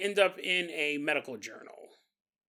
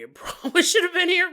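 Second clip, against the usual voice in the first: laughing; 11 semitones higher, 6 dB louder than usual. Real, not acted. Joey quote breaks into laughter.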